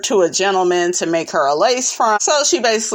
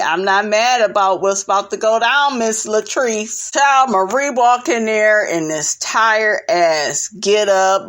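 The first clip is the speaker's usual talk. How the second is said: ordinary delivery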